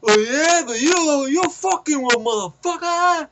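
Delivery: high, loud mock fury; hammed-up raging comeback; imitated angry challenge. Young guy squaring up to older man, mock furious.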